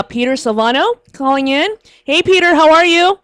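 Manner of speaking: usual speaking voice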